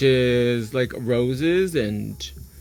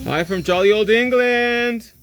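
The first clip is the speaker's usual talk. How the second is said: jolly English greeting; 9 semitones higher, 6 dB louder, slower than usual. Pretend. Greeting UK viewer.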